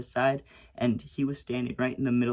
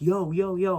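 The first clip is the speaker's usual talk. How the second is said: high, loud urgent call-out; retold yelling; 7 semitones higher, 6 dB louder than usual. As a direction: yell it as if through a locked door. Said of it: "I'm yelling".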